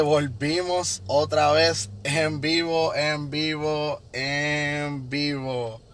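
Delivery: slow, drawn-out show opener; stretched live intro. Acted. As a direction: stretch each en vivo as it fades. Opening a live stream.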